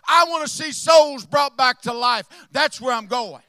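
loud, rising preaching to the church; full-force preaching. Acted. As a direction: drive it home like a revival preacher.

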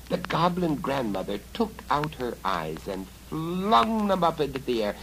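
ordinary delivery

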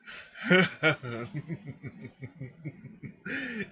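slow villainous chuckle; evil laugh. Acted. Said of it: "That was an evil laugh".

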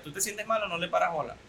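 normal talking voice

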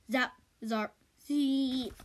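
loud, rising zap noises; electric zaps. Acted. Shark getting electrocuted, zapping noises. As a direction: crackle each zap.

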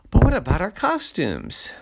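put-on high, loud kid's protest; high-pitched childish dismay; worried child. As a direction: cry out like an alarmed little kid. Kids worried about costumes.

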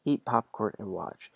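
usual speaking voice

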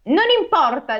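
high, dismissive crow voice; crow character. Acted. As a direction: brush it off as the storybook crow. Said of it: "disse la cornacchia".

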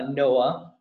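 ordinary talk

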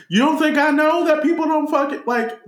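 high upset toy voice. Retold or imitated. Voicing crying Charlie in the Box.

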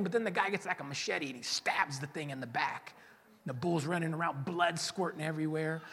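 usual speaking voice

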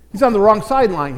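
ordinary talk, nothing marked

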